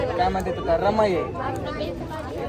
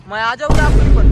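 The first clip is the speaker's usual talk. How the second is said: shouted teasing question, much louder; louder repeat of the teasing question; loud call; 3 semitones higher, 10 dB louder than usual. Real, not acted. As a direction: call it out loud across the crowd. Calls out the question again.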